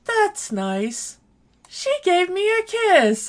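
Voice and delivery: high, dopey put-on voice, rising on the second line; mocking impression. Friend mocking a spaced-out guy in a high dopey voice. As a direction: play him as dazed and clueless. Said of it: "And he just goes".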